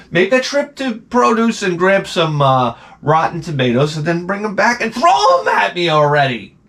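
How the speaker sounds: high sarcastic rant ending sharp; exasperated sarcasm. Retold or imitated. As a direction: sarcastically invite their abuse.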